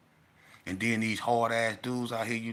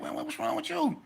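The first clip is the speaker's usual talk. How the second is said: confused asking. Retold or imitated. Onlookers confused by the scene.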